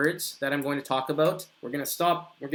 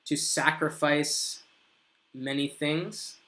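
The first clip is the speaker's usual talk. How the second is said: slow model sentence; slower than usual. Shown as a demonstration. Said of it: "you could say".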